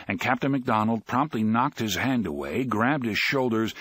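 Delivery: ordinary delivery, nothing marked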